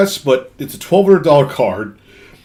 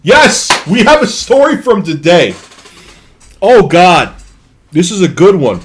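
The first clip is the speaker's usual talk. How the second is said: high, loud burst of delight; excited; 6 semitones higher, 6 dB louder than usual. Real, not acted. Streamer thrilled to have today's story to tell, excited. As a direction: exclaim it with big, eager energy.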